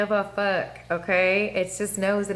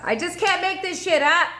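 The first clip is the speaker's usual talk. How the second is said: excited exclamation; 10 semitones higher than usual. Real, not acted. Excited at astrology coincidence.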